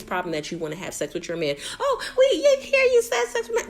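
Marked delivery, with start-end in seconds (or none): 1.8-3.7 much higher squealing mockery of her complaint; mocking whiny housemate. Recapper mocks the houseguest's complaint in a high squeal.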